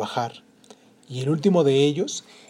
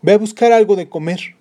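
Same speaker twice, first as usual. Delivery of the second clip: husband's voice; 7 semitones higher, 10 dB louder than usual. Acted. Husband sends wife.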